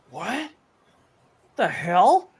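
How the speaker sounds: rising disbelieving what, then loud hell; stunned what the hell; shocked teacher. Retold or imitated. Teacher shocked by the raised hand.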